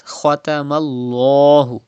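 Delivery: "Khatamallahu" with a dark, rounded "lloh"; thick Lam. Shown as a demonstration. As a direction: make the l of "Khatamallahu" heavy and long. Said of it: "read Lam with thicker voice like this".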